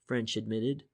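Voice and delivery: ordinary delivery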